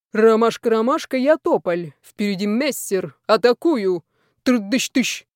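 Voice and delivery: punchy call sign, then imitated gunfire; mock fighter-pilot radio call. A narrator's reading. Player shouts from the lift in a game, mock pilot. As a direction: play at war pilot calling base.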